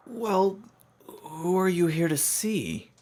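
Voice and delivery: high, loud, drawn-out challenge; nervous young pony. Acted. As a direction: push the question out high and tense. Young pony questions stranger.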